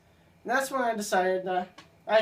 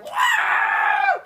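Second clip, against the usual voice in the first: startled yelp; 9 dB louder than usual. Retold or imitated. Retold scream while falling.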